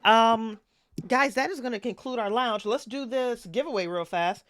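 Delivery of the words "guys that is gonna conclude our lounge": ordinary talk, nothing marked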